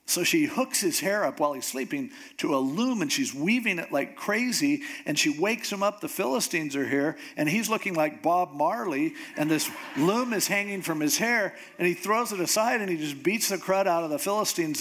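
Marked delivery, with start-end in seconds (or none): none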